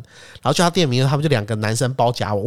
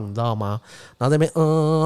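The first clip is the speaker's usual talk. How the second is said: ordinary talk, nothing marked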